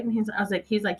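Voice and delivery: ordinary talk, nothing marked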